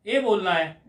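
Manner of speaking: normal talking voice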